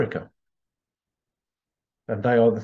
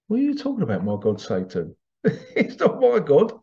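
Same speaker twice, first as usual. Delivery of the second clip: incredulous; 6 semitones higher than usual. Retold or imitated. Acted baffled reply to an accusation.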